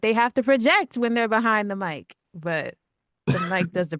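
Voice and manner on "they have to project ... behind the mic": projecting. Shown as a demonstration. Showing projecting behind the mic.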